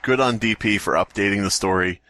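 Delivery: usual speaking voice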